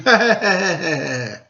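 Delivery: rat's sneering chuckle; a short taunting laugh; mocking laugh. A narrator's reading. Rat laughing at the Daimyo, mocking chuckle. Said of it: "laughed at him".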